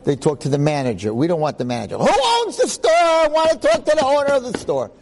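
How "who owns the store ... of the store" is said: much higher, insistent voice demanding the owner; squeaky, indignant demand to see the owner; demanding customer. Retold or imitated. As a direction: play a pushy customer who wants the boss.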